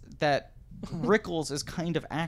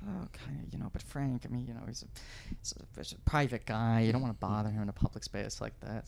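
a hint of evasive [name]; 2 semitones lower, 7 dB quieter than usual. [name] replying to date.

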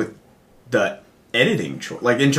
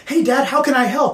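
lightly voiced sweet young girl, higher; high daughter voice; 12 semitones higher than usual. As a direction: chirp it like an eager daughter. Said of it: "a daughter who is like".

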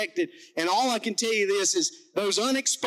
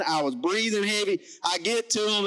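ordinary talk

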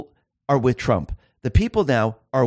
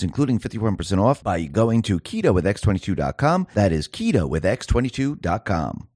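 lower, steady sponsor read; 6 semitones lower than usual. Acted. Host reads gold investment ad to listeners, smooth ad voice. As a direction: sell it like a radio spot.

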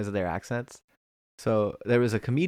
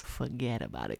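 softer, put-on New Jersey accent; 9 dB quieter than usual. Shown as a demonstration. Teasing co-host quietly, New Jersey accent. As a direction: toss it off New Jersey style. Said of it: "I can do the New Jersey accent".

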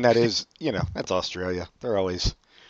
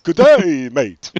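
hammed-up Australian "Good day, mate", loud and high; a mock master of accents doing Aussie; over-the-top Australian greeting; 5 semitones higher, 12 dB louder, much slower than usual. Shown as a demonstration. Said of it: "here's how you do it".

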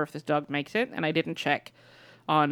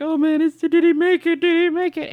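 high, louder worried questioning; anxious viewer; 10 semitones higher, 5 dB louder than usual. Retold or imitated. Viewer reacting as the dogs return, anxious. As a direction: fret aloud over a favorite dog's fate.

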